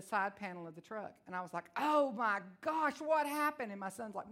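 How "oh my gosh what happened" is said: shocked gasp. Retold or imitated. Mom finds dent in truck.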